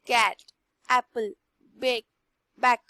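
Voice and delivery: कैट, एप्पल, बैक each said slowly with a clear open ए; careful demo. Shown as a demonstration. Teacher models words for students, carefully. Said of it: "आप ध्यान से बोलोगे".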